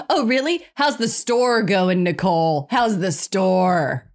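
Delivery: playful imitation of a dry stepdad; sarcastic stepdad. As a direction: needle her slowly about the store. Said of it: "And he's like".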